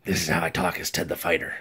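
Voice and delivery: gruff superhero rasp, kept soft. Shown as a demonstration. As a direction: make your voice grainy and low. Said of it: "Make your voice grainy and low".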